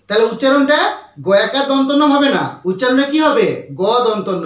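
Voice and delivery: normal talking voice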